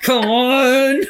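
laughing. Real, not acted. Urging him through laughter.

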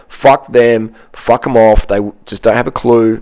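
ordinary delivery

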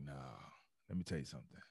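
low, soft rasp, lightly voiced; raspy Mike Anderson. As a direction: hush it down like a wise veteran. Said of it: "he got this real raspy voice".

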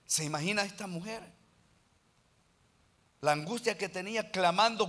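ordinary talk, nothing marked

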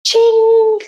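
high, bright magic sound; magic ching. Acted. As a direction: sing out a magic chime. Magic sound effect of wish.

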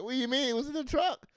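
high-pitched disbelieving customer; incredulous protest. Acted. Customer challenging the postal clerk's excuse, high incredulous protest.

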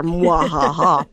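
slow mock evil cackle; hammed-up villain laugh, drawn out; sneering panto villain. Rules lawyer evil laugh.

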